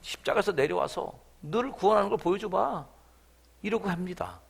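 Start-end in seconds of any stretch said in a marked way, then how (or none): 0.0-2.8 louder mocking dare; crowd taunt. People below the cross daring Jesus, mocking. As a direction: dare him to come down, scornful. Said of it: "사람들이 얘기합니다".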